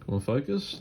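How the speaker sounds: ordinary talk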